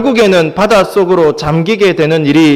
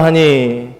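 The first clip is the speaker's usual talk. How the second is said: normal talking voice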